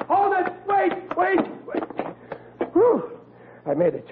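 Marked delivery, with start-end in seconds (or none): 0.1-1.8 put-on high shouts after a departing boy; calling out. Father shouting for his son to wait, high frantic calling. 2.7-3.1 relieved whew. Acted. Made it onto the bus.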